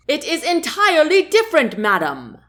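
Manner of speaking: high, fast, loud shout. Acted. Chancellor to queen, a fast, high, loud shout. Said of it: "Dolian shouted".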